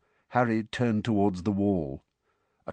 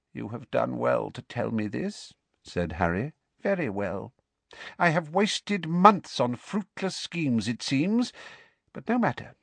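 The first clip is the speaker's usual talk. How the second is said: cold dark lord; 4 semitones higher than usual. Acted. Dark lord addresses servant.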